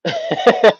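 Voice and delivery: loud, high burst of laughter. Real, not acted. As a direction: crack up out loud. Laughing at a comment on live.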